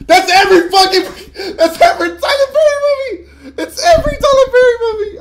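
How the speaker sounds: very high, loud exasperated yell. Real, not acted. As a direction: lose it and screech in real frustration. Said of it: "I know I'm yelling. I know I'm loud".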